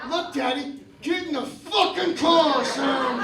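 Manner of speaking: loud, slow furious yell; angry yelling. Retold or imitated. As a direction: bark it at the kid. Dad yelling at son.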